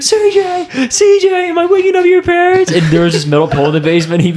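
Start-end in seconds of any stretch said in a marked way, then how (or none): 0.0-2.7 very high, squealed imitation. Friend in the basement late at night, high mock holler. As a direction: send the voice way up, mocking a friend who carries. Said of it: "his voice just carries over, like, a mile".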